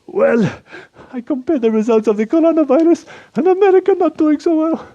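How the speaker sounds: put-on a proud Eastern European accent, voice pushed up high; Slovak accent. Anton gloats about Slovakia's covid numbers in a high Slovak accent.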